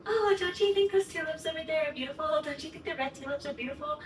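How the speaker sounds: put-on hint at the view like a sly boyfriend; imitating fiancé. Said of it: "Derek keeps going like".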